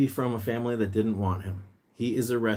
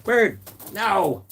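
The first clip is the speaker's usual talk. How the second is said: loud high call; loud high-pitched shout to a pet; 14 semitones higher, 11 dB louder than usual. Real, not acted. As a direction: call after the bird across the house. Calling bird that fled room.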